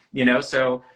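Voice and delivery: normal talking voice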